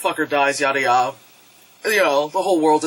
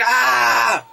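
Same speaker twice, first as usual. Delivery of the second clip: drawn-out high "ah" roar; hammed-up Godzilla roar; imitated roar; 5 semitones higher, 3 dB louder than usual. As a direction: give one last mock monster roar. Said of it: "like, roar".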